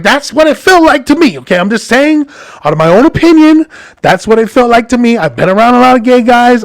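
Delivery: high-pitched, mildly defensive protest. Retold or imitated. Husband justifies his opinion to his wife, mildly defensive. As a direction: push back, pitched well up.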